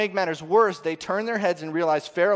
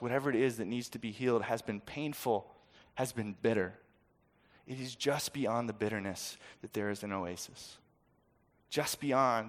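soft, lower consoling voice; gentle encouragement; 4 semitones lower, 7 dB quieter than usual. Real, not acted. Pastor consoling the congregation, soft and low. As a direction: comfort the hurting listener.

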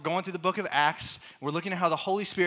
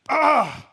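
high, loud "Ah!" of regret; loud, exasperated outburst; frustrated groan of regret; 11 semitones higher, 13 dB louder than usual. Acted. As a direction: burst out with a regretful cry. Moments of regret acted out.

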